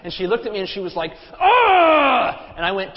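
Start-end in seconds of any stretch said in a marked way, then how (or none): 1.4-2.5 high, loud scream of exasperation; frustrated scream. Retold or imitated. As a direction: imitate an angry wife's wordless scream. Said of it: "she got so frustrated at me".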